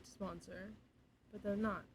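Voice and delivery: soft, hushed aside; quiet. Shown as a demonstration. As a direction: murmur it under the breath. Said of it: "I'm talking so quietly".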